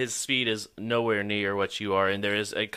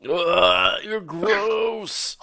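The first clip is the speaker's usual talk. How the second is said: mock disgust; 10 semitones higher, 8 dB louder, much slower than usual. Real, not acted. GM reacts to seven hits.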